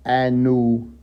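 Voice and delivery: Anu, Elion, Elion, El each called out with long pauses; solemn roll call of names; intoned names. Shown as a demonstration. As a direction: chant the names slowly. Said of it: "intoned names with long pauses".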